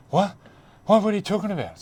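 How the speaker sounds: high, loud flustered squeak; panicked denial. Retold or imitated. Teammate rattled by the bourbon joke, squeaking high and flustered. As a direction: play the mate caught out and panicking.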